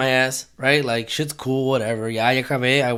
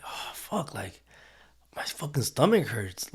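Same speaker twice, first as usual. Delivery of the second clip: whispered, voiceless groan of stomach pain; 3 semitones lower, 10 dB quieter than usual. Retold or imitated. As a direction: hush it like you're hiding cramps. Quiet pained thought at movie.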